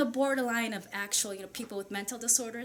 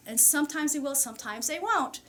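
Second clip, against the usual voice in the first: ordinary delivery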